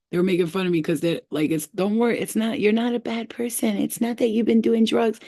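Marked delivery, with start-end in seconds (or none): 1.5-5.2 slightly raised little creature voice; lightly voiced munchkin, consoling before the tease; shroom munchkin. Shroom guys reassuring the tripper, lightly voiced munchkin. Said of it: "They were like shroom munchkins".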